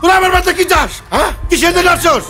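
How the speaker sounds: high, tearful frightened demand. Acted. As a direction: cry out in fear at intruders. Woman challenges intruders, frightened.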